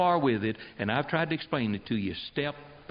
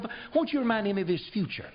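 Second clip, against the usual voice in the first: higher, faster retort; 8 semitones higher, faster than usual. Acted. Preacher shouts back retort.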